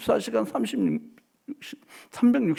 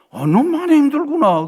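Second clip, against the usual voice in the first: God's voice; 7 semitones higher, 7 dB louder than usual. Acted. Preacher voices God answering kindly.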